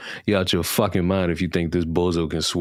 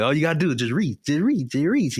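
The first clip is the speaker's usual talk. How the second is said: higher on each reach, teasing; mocking taunt, rising higher; 7 semitones higher than usual. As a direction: climb up in pitch like stretching for it.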